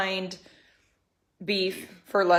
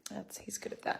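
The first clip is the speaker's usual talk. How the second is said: whispering; 19 dB quieter than usual. Real, not acted. Teasing aside about her husband.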